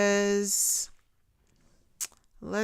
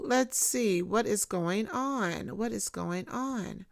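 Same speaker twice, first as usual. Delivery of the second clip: ordinary delivery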